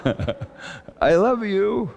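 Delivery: put-on lingering childlike I love you; tender child voice. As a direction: draw it out like a little girl soothing. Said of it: "she said".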